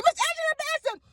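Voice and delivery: very high, triumphant shout of the name; excited shout. Retold or imitated. Caller shouting the actress's name to the host, very high. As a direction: nail the answer with delight. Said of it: "I said".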